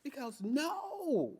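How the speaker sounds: hammed-up squeal of protest; high-pitched objection. Patient objecting to the dead-looking dialysis center, high-pitched protest.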